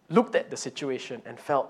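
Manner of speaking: normal talking voice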